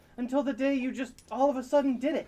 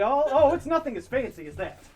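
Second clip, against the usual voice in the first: ordinary talk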